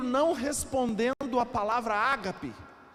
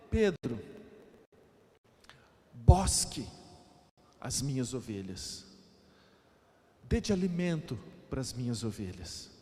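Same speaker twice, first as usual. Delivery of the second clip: tender low command; 11 semitones lower, 3 dB quieter than usual. Acted. Preacher voicing Jesus telling Peter to feed his sheep, tender and low. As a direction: speak it like a shepherd quietly entrusting his flock.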